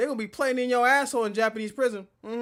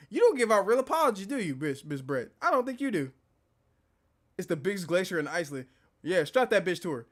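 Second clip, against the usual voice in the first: normal talking voice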